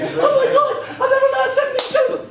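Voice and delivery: very high, fast mock squeal of panic; squeaky panicked audience member. Comic poet mocks a panicked audience member, very high squeal. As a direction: squeak it out like a frightened punter who never learned the poem.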